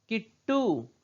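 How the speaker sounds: किट्टू split as 'kit-too' with a held ट; half consonant. Shown as a demonstration. Teacher modelling the word for children, किट्टू as 'kit-too'. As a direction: stop on the half ट, then release टू. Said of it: "ये आधा इसका उच्चारण हम कर रहे हैं".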